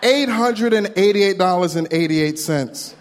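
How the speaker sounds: slow, stunned read of each number; a slow stunned reading of the bank balance; slow disbelief. Real, not acted. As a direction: spell it out slowly in shock. Slowly reading shocking balance.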